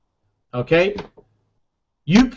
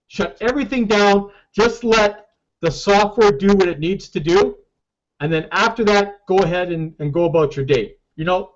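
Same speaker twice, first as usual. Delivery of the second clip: ordinary delivery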